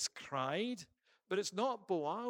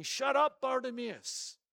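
louder, higher angry shout to shut him up; crowd shouting; 7 semitones higher, 4 dB louder than usual. Acted. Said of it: "they're shouting at him".

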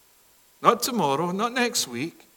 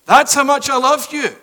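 ringing declaration; 7 semitones higher, 8 dB louder than usual. Acted. God declares love from cross.

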